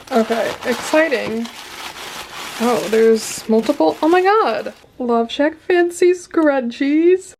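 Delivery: loud, thrilled unboxing squeal; excited. Real, not acted. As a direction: gush over the gift, loud and drawn out. Unboxing a gift package.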